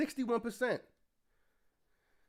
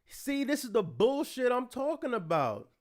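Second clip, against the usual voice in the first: ordinary talk, nothing marked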